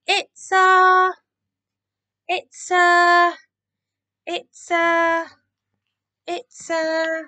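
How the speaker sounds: high, drawn-out search for a word; stalling. A narrator's reading. Keith inventing an excuse for the cats, stalling.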